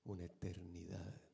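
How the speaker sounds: slow hushed whisper; dramatic whisper. Acted. Preacher whispering the sad cost to the congregation. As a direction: drop to a whisper for weight.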